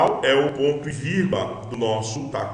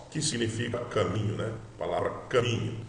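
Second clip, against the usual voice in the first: usual speaking voice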